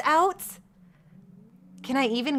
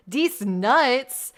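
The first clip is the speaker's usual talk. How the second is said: usual speaking voice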